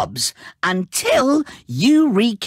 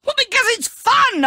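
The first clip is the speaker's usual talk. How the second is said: gleeful; 12 semitones higher than usual. Acted. Villain gleeful about his scheme.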